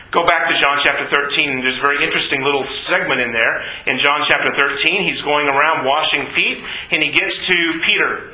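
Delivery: ordinary talk, nothing marked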